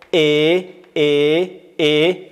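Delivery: loud drawn-out "e" on "ای"; kasra vowel. Shown as a demonstration. Teacher drills kasra, loud steady "e" on "ای". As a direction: hold "ای" as plain "e", without a glide.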